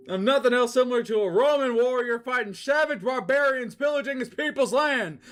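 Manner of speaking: high, loud grandiose villain; high, loud boast; Starlo's voice. Acted. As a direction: proclaim it like a warrior.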